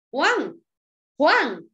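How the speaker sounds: lightly voiced exclaiming recognition; mild exclamation. Shown as a demonstration. María recognising Juan, exclaiming mildly. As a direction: call the name out with a touch of surprise. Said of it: "se da cuenta de que es Juan y exclama".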